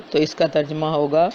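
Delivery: usual speaking voice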